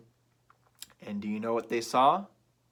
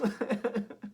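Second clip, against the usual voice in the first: laughing; 11 semitones higher than usual. Real, not acted. Teller laughs after voice.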